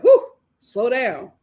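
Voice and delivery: slow, loud whew of disbelief; mock exasperated. Vlogger telling church kids to slow down, slow and loud. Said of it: "I'm just like".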